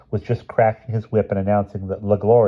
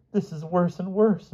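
high, slow moan of dismay; 10 semitones higher, 3 dB quieter than usual. A narrator's reading. Mother as things go wrong, moaning. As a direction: draw it out in woe.

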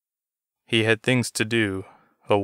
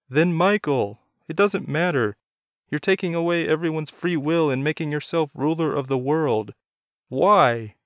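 upset confrontation; 7 semitones higher than usual. A narrator's reading. Doug demands Jason explain himself, higher and pressing. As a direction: confront him, rising.

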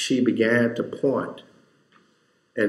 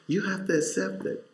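higher, soft repetition with rising urgency; raised soft appeal; pleading; 8 semitones higher, 6 dB quieter than usual. Real, not acted. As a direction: lift it up as a plea. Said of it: "But you have to accept it".

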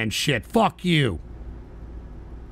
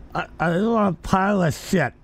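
"I live on a pirate ship" blurred, tongue held out; holding tongue with fingers; 4 dB quieter than usual. Shown as a demonstration. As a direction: say "pirate ship" while pinching your tongue. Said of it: "hold your tongue right now and say".

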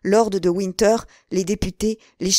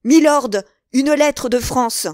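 high, loud call; shouted announcement; 6 semitones higher, 7 dB louder than usual. A narrator's reading. Patrick bursts in to the duke, shouting the news. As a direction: cry out the news across the room. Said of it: "entrait dans la salle en criant".

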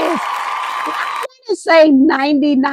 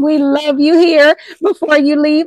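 usual speaking voice

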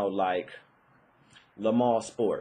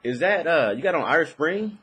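lightly voiced girlfriend, raised and louder; a hint of a curious girlfriend sniffing the air; playful imitation of the girlfriend asking; 6 semitones higher, 5 dB louder than usual. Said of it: "she was like".

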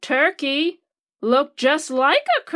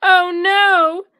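higher, louder dismayed turkey; worried turkey voice; 4 semitones higher, 5 dB louder than usual. A narrator's reading. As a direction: gasp it out as a fretful turkey. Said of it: "thought Turkey".

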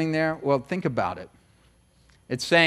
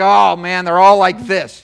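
mock loud complaint; 2 semitones higher, 9 dB louder, slower than usual.

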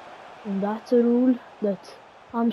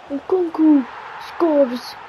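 higher, louder excited goal shout; goal call; 6 semitones higher, 6 dB louder, much slower than usual. Acted. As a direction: scream the goal like a commentator.

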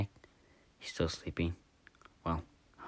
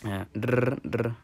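toy engine noise; 3 semitones higher than usual. Pretend. Imitated machine noise.

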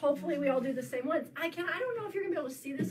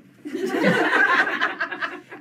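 loud laugh; 5 semitones higher, 11 dB louder than usual. Real, not acted. Laughs after skinny joke.

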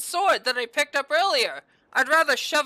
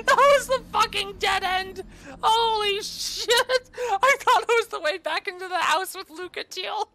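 high, wailing half-laughed disbelief; laughing helplessly; 9 semitones higher, 3 dB louder than usual. Real, not acted. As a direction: wail it through helpless laughter, pitched high. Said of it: "I don't know why I'm laughing".

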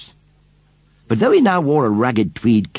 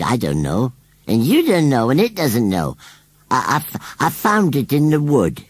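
evasive rustic scarecrow; 5 semitones higher, 4 dB louder than usual. A narrator's reading. Scarecrow dodges Susan's question about the baby, evasive. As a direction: play a scarecrow dodging the question. Narrator voices Gummidge the scarecrow.

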